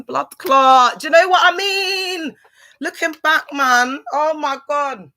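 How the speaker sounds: high, loud regretful exclamations; excited exclaiming. Real, not acted. Vlogger regretting the fun she missed, exclaiming high and loud.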